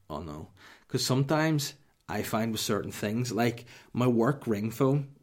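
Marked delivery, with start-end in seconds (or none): 0.1-0.5 quiet dread. Retold or imitated. Dad's dread on hearing daughter.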